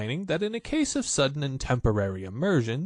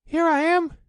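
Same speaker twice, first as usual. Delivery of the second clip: very high, loud squeaky little voice; Roo squeaking; 7 dB louder than usual. A narrator's reading. Roo showing off his swimming, high squeak. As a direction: squeak it like a tiny proud joey. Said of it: "squeaked Roo".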